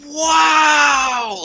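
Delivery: long, high, loud shout of wonder; kid's wow shout. Retold or imitated. A dad re-enacting his son's loud, high wow. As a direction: belt it like a thrilled child. Son pulls out card pack.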